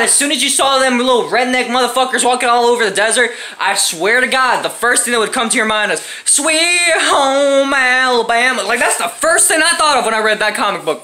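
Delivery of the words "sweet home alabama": slow, sung-out Southern country twang; country accent. Retold or imitated. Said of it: "Why am I talking country now?".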